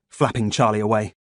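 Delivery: plain reading voice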